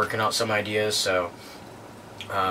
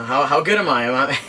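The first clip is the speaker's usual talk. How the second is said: put-on playful English accent, a bit louder; 5 semitones higher, 5 dB louder than usual. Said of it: "trying to do my English accent".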